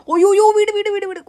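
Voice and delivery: high, rapid-fire yelping; panicked recoil. Acted. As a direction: yelp as if fleeing an awful stench. Recoils from a terrible smell. (പരിഭ്രമിച്ച് ഉച്ചത്തിൽ പിടഞ്ഞ്)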